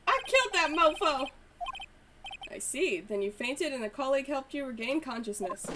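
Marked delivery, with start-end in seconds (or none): 0.1-1.3 high, loud eager officer voice; annoying voice. Acted. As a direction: squeak out every sir, overeager rookie. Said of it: "I'm gonna do a really annoying voice".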